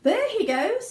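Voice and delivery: high, loud shout; onlooker shouting. A narrator's reading. Onlooker in the crowd shouting, high and loud. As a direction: point and shout it out. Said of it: "somebody shouted".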